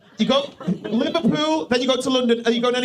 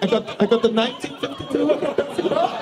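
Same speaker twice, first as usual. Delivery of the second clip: put-on camp German accent; 2 semitones higher than usual. Comedian repeats Toby's train line, camp German accent. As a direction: play up a camp German traveller, amused.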